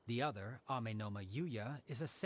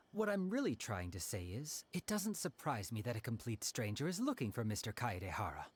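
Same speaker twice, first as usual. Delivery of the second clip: ordinary delivery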